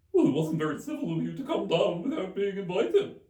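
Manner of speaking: high, loud hare retort; March Hare voice. A narrator's reading. March Hare snapping back at Alice, high and loud. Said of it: "said the March Hare".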